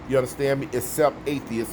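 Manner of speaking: normal talking voice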